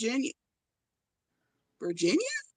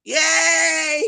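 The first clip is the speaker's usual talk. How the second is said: cheer; 5 semitones higher, 4 dB louder than usual. Real, not acted. Cheering that the stream now works.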